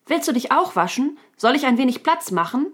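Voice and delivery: high, friendly raccoon voice; bright-pitched, politely offering question. A narrator's reading. Raccoon kindly offers the unicorn a place to wash, friendly. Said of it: "Der sprach es freundlich an".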